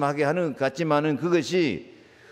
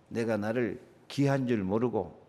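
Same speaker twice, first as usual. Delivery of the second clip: normal talking voice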